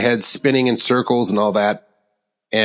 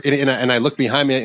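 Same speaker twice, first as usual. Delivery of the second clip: usual speaking voice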